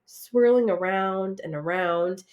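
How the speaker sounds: usual speaking voice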